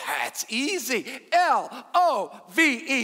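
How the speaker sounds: slow, loud, letter-by-letter spelling; gleefully drawn-out letters; confident spelling. Acted. Joke: woman confidently spells her word.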